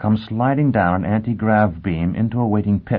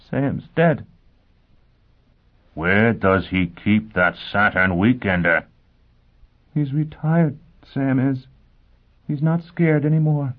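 dazed grieving woman; 5 semitones higher than usual. A narrator's reading. Gisbella in grief, dazed.

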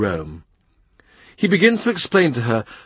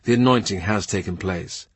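ordinary delivery, nothing marked

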